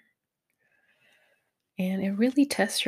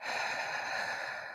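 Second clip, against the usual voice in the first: long audible breath out; sigh; 12 dB quieter than usual. Shown as a demonstration. Meditation guide showing listeners how to breathe, long sigh. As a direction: let out a slow, airy sigh. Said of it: "sigh it out".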